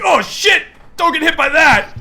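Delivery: a hint of a startled enemy yelping; panicked dodge. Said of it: "He dodges out of the way like".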